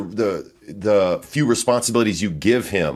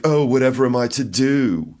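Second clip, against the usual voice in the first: mock British fret, pitched up; hammed-up British accent; 5 semitones higher than usual. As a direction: play the helpless Englishman, overdone. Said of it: "He's British as well".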